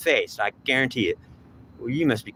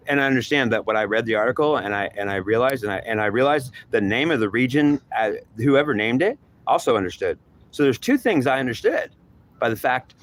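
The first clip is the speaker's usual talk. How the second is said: ordinary talk, nothing marked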